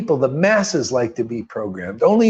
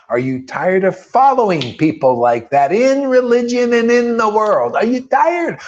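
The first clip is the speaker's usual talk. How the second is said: each question higher and louder; rising preacher's call; 8 semitones higher, 3 dB louder than usual. Real, not acted. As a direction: build the challenge to the congregation. Preacher's building challenge to listeners.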